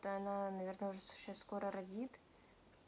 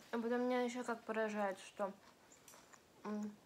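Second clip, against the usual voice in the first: talking while eating; chewing between words; mouth full, chewing. Real, not acted. Seen 0: Chews throughout; puts pickled ginger in mouth at 8.5s, cheeks full.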